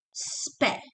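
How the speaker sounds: "spe" as one syllable, long S; no vowel between S and P in "spe"; S straight into P. Shown as a demonstration. Teacher modelling for students, joined "spe". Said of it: "not sebe".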